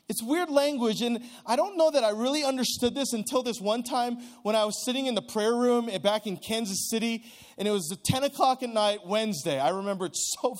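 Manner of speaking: ordinary delivery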